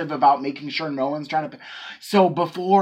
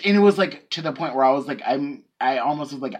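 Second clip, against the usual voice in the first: usual speaking voice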